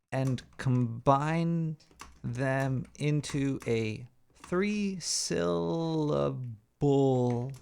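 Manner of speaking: very slow, spaced-out typing read; slow stoned dictation. Acted. Dictating a prompt to ChatGPT, slow and spaced out. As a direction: drawl each word out like typing stoned. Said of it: "This is a stoned special".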